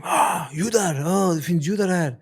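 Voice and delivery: high, loud excited yell; retold scream. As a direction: imitate kids screaming on spotting someone. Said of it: "they scream".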